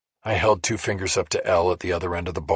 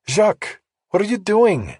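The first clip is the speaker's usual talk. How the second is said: put-on high, loud bartender with a French accent; 13 semitones higher, 6 dB louder than usual. A narrator's reading. Bartender greeting a regular, French accent. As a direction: call out his name the French way. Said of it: "spoke with a French accent".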